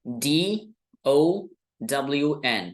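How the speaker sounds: डी ओ W एन spelled letter by letter, slowly; slow spelling out. Shown as a demonstration. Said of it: "दूसरा word आपको दिख रहा होगा". (धीरे-धीरे अक्षर बोलकर स्पेलिंग)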